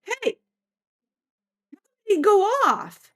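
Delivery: high-pitched baffled voice; puzzled spirit. Retold or imitated. Unseen prankster finds the sensor dead, high baffled voice. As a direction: play a prankster whose toy has failed. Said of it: "I hear this".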